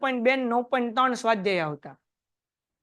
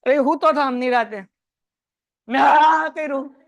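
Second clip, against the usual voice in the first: sudden loud slow yell to scare; retold shout; 6 dB louder than usual. Teacher reenacting yelling at sleeping student, loud and drawn out. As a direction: boom it out like a prank scare. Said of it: "રાડ નાખી".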